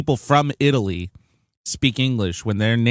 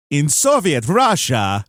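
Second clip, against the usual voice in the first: light mock Russian accent, slow and louder, much higher on the punchline; 4 semitones higher, 4 dB louder, slower than usual. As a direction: ham up a Russian comic accent for the joke. Soviet Russia joke punchline.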